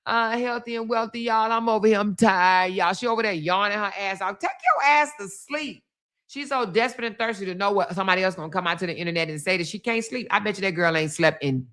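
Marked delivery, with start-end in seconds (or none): 4.4-5.8 scolding. Acted. Scolding the yawning woman.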